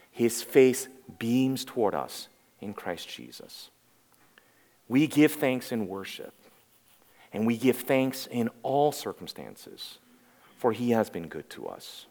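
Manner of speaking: low, soft and reverent; hushed reverence. Real, not acted. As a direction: let it settle into quiet gratitude. Hushed, reverent close of a point.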